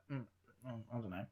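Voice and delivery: very quiet, low half-asleep mumble; sleepy mumble. Retold or imitated. Half asleep on a late call, mumbling very quietly. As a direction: mumble it barely awake. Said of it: "I was just asleep".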